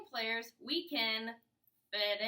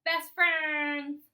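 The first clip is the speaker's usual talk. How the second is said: loud stress on "best"; 3 semitones higher, 10 dB louder than usual. Shown as a demonstration. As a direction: belt out "best friends". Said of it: "are gonna be best".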